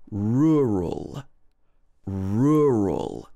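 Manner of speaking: Rural slow with both r sounds clear; careful rural. Shown as a demonstration. Teacher modelling the word rural, careful. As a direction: shape each r in Rural. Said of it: "this should be".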